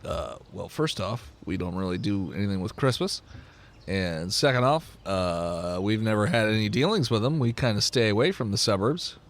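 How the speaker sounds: low, quiet, reserved voice; unimpressed tribe leader. Acted. Tribe member turns down visitors' questions, low reserved voice. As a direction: answer low and reluctant.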